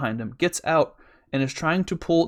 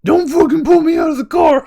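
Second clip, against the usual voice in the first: very high, loud mock-panicked scream; imitated screaming protest; 14 semitones higher, 11 dB louder than usual. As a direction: scream it like someone being dragged. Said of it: "you can literally hear him be like".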